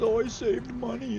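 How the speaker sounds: high, pleased mock shopper voice; mocking a shopper who thinks a sale saved money; smug bargain hunter. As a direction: parody someone proud of a sale. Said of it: "the concept that literally runs to the store and says".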